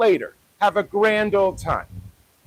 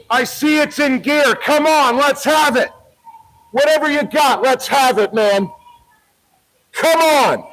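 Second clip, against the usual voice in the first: raised, goading challenge to the driver; taunting dare; 5 semitones higher than usual. Real, not acted. Daring the driver in the car to reverse, goading. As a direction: bait him, come on, let's have it.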